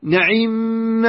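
نعم as na'imma, open a on the nun; fatha nun, kasra ayn. Shown as a demonstration. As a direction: say na-'imma for نعم, i on the ayn and doubled m. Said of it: "فتح النون، فتح النون وكسرة العين".

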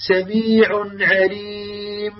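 slow recited سميع عليم with an over-long nasal on the mim; wrong extra ghunna. Shown as a demonstration. Teacher reciting the mistake slowly. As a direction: drag the hum where it doesn't belong. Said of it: "الخطأ سأقرأ".

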